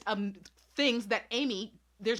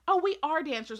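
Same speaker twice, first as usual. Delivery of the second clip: lightly voiced bratty girl, higher and louder; mock girl voice; 7 semitones higher, 4 dB louder than usual. Retelling Amy's claim to the staff member, lightly mocking girl voice. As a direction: play a child faking confidence, pitched up. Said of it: "And Amy's like".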